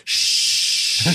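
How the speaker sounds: long forceful "shh"; retold shush. Marine cuts off the girl interrupting his confrontation, long shush. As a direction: shush someone mid-sentence.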